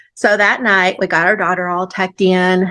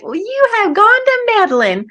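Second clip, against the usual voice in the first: mock indignant; 13 semitones higher than usual. Retelling her protest to friend.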